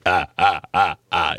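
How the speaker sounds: loud, choppy gruff "ah" noises; short rough mimicked grunting; gruff grunts. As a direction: imitate a gruff old man's repeated grunts. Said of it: "He would talk like that".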